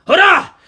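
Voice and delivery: loud, high yelp as the shock hits; a jolted scream; strangled cry. A narrator's reading. Robber's cry as he's tased.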